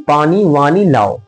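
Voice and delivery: slow teacherly enunciation of the phrase; measured, drawn-out example for students; slow, deliberate repeat. Shown as a demonstration. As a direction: spell it out slowly, word by word. Teacher repeats example slowly for class.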